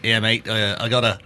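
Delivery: imitated New Zealand accent; relaxed New Zealand imitation. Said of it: "New Zealand accent".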